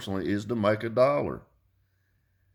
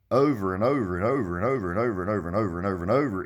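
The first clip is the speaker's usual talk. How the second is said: fast, sing-song run of repeats; rapid repetition; much faster than usual. Real, not acted. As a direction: rattle it off in a rolling rhythm. Stressing endlessly repeated failures.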